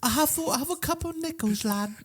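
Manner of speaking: high squeaky ghost voice; broke ghost. Acted. A broke ghost pleading his nickels, high squeaky. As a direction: squeak out your spare change.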